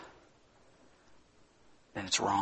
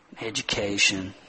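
normal talking voice

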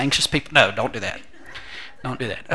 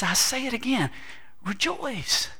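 rejoice said higher the second time; higher repeat; 9 semitones higher, 6 dB quieter than usual. Shown as a demonstration. Preacher repeats Paul's call, rejoice said higher. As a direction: lift it on the repeat. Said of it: "He says".

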